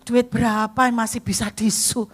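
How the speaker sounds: loud, disbelieving protest; loud mock outrage about being sued; indignant outcry. Real, not acted. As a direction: throw the question out in outrage. Indignant exclamation at sue threat.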